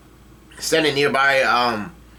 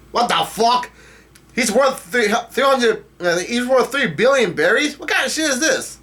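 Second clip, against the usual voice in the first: high-pitched, incredulous outburst; stunned disbelief; 10 semitones higher than usual. Real, not acted. Reader balks at a three billion berry bounty, stunned. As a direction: react in astonishment, voice jumping way up.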